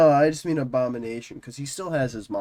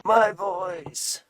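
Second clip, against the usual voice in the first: from another room; 6 semitones higher, much slower than usual. Acted. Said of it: "This is loud as you can speak".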